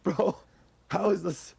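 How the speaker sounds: strained, failing voice; hoarse, voice giving out; losing voice. Real, not acted. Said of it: "I'm losing my voice".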